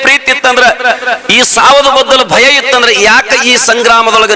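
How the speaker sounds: high, ringing sermon voice; fervent village preacher; preaching at full force. Acted. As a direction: preach it out to the crowd. Mother's rebuke, preached high.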